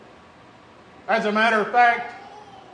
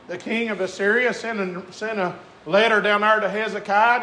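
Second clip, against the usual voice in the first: usual speaking voice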